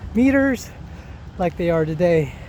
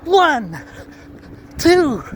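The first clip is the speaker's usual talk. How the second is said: full power count; 9 semitones higher, much slower than usual. Shown as a demonstration. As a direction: shout each number out. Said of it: "Hundred percent".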